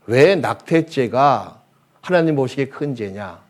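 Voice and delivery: normal talking voice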